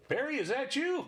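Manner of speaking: lifted own voice calling. Acted. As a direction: call up to the roof. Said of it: "I artificially make my voice a little bit higher".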